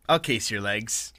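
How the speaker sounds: usual speaking voice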